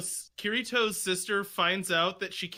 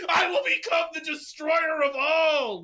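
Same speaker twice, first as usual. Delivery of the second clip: high, shouted hero boast; dramatic anime shout; 8 semitones higher, 4 dB louder than usual. Retold or imitated. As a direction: bellow it like an anime villain. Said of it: "a guy that's like".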